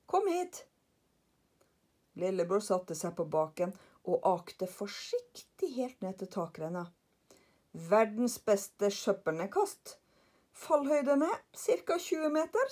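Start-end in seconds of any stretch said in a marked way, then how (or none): 0.0-0.6 high-pitched call "Kom hit!". Acted. Karlsson calls lillebror down to the gutter, high-pitched. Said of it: "ropte Karlsson". 7.7-12.7 slow, grand announcement rising higher; proud Karlsson. Acted. Karlsson proudly shows lillebror the drop, slow.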